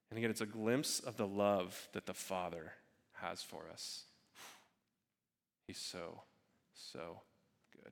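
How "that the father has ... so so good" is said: low, very soft, lingering reverence; hushed awe. Real, not acted. Preacher marvelling at the Father's love, hushed awe. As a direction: let it sink almost to nothing, pausing between phrases.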